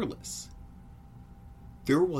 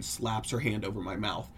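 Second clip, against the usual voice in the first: usual speaking voice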